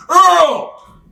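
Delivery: sudden high, loud bellow; catchphrase yell. Retold or imitated. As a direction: holler the name at full voice, way up high. Shouting out character catchphrase in interview.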